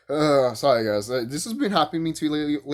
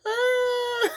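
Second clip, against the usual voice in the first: emotional aww. Retold or imitated. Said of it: "and I'm like".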